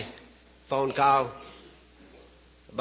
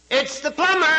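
parrot voice; 12 semitones higher, 4 dB louder than usual. Acted. Parrot answers the old lady from inside, high squawk.

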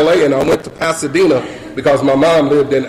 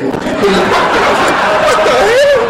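shocked alarm; slower than usual. Retold or imitated. Comic retelling of her lip suddenly dropping, shocked alarm. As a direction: play up the bewildered outburst.

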